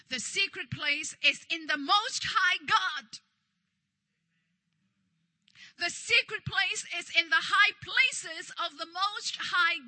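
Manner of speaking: loud, emphatic sermon delivery; forceful preaching. Real, not acted. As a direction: proclaim it out to the whole congregation. Preaches to congregation.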